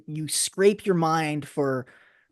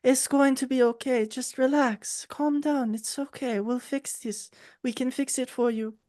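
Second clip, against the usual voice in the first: much higher, soothing gnome voice; rock gnome; 9 semitones higher than usual. Acted. Small gnome soothing the eyeless man, much higher and gentle. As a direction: coo it like a tiny gnome calming a friend. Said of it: "she's a small rock gnome".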